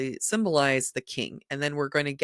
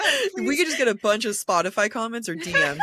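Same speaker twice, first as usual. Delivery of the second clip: laughing; 6 semitones higher than usual. Real, not acted. Asking listeners while laughing.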